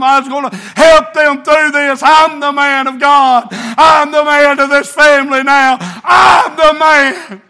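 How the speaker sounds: rising to a slow, loud declaration; building preaching. Acted. Preacher recalling his mother's funeral, building preaching. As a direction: swell into it, land each word. Preacher relives being the man.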